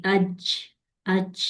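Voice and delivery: "اج" ending in an airy "ch"; wrongly released jeem. Shown as a demonstration. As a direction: let the end of "اج" leak into a "ch". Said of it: "یہ والی آواز".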